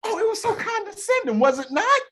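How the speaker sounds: high, outraged "it was so condescending"; incredulous. Real, not acted. Outraged agreement about tone.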